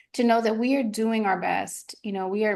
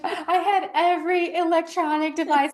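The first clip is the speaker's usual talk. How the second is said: put-on higher, whiny grown-up child complaining; a son in therapy mocking his doting parents, higher voice; mock grown kid in therapy; 8 semitones higher, 4 dB louder than usual. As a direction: play an adult kid griping to a therapist, pitched up. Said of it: "And he's like".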